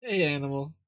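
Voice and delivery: ordinary talk, nothing marked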